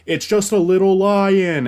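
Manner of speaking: flat remake reading. Retold or imitated. Mocking the remake's Pumbaa line, high and empty. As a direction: parody a line said without knowing why. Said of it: "you didn't say the line right".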